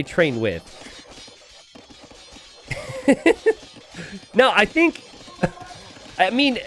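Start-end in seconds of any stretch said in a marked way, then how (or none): 3.1-6.6 laughing. Real, not acted. Caster laughs at co-caster.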